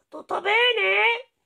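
high, slow sing-song wife voice; wolf's wife. Acted. Wife greeting the wolf home, high and slow. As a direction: ask sweetly and high. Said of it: "la moglie gli disse".

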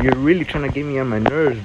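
ordinary talk

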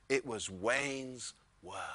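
whispered. Real, not acted. Reverent aside about Wayne.

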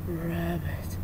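slow, creepy whispered line. Acted. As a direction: breathe it slowly, like a creep. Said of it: "even my creepiness has its limits".

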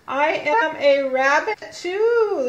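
slow, high and loud read-aloud; emphatic corrected reading. Shown as a demonstration. Teacher rereading after mistaking rabbit for bunny, slow and emphatic. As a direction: stretch the sentence out loudly to fix it.

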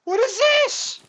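high, loud startled shouts; freaking out at the naked mobs; shocked yelling. Real, not acted. Naked mobs walking toward them.

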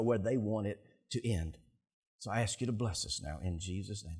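soft, low praying voice; closing prayer. Real, not acted. Pastor prays for each person here, hushed prayer.

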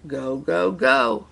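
storyteller's climbing crowd chant; trumpeting crowd. A narrator's reading. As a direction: build the cheer to a shout. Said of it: "the crowd trumpeted".

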